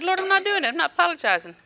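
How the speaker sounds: stubborn protest to the Lord; stubborn refusal. Retold or imitated. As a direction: dig in your heels. Retelling refusal to God.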